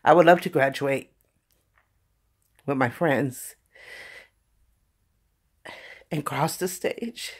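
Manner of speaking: real emotion welling up. Real, not acted. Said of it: "I'm getting emotional about it".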